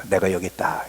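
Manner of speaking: low, hushed voice of God; quiet, low divine reply; God answering. Retold or imitated. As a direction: answer softly and deeply as God. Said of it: "하나님이 대답을 하는 거야".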